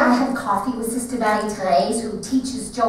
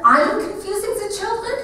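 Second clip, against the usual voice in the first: high, offended question; indignant disbelief; 8 semitones higher than usual. Acted. Mama throwing the accusation back at Papa, indignant and high. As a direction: throw the blame back at him.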